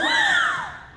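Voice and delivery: very high gasp of shock. Acted. Onlooker hearing the murder confession, very high gasp.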